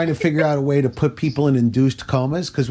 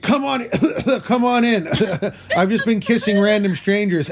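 higher, giggling through a fake invitation; laughing mock welcome; 7 semitones higher than usual. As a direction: invite the burglar in while cracking up. Mock greeting a burglar while laughing.

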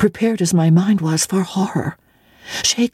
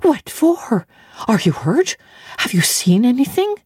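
alarmed questions; 7 semitones higher than usual. Acted. Servant questions frightened child.

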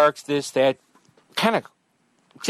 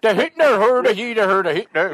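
Swedish chef gibberish; 7 semitones higher, 4 dB louder than usual. Pretend. Silly Muppet chef gibberish.

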